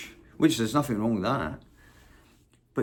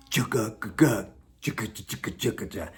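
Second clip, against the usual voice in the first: soft lower scat of a chugging beat; quiet imitated rhythm guitar chug; mouth rhythm; 5 semitones lower, 3 dB quieter than usual. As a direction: mimic a drum groove with your mouth. Mimics the song's rhythm.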